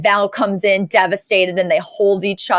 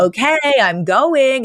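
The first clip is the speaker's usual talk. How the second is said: mock huffy exit; 6 semitones higher, slower than usual. Daughter leaving with suitcase.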